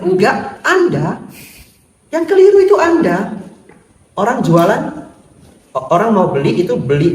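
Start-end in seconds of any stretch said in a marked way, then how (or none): 0.0-3.3 high, loud mock retort; inner retort. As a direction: silently shout back at the rude boss. Dalam hati membantah bos galak. (bantahan dalam hati yang dibuat-buat)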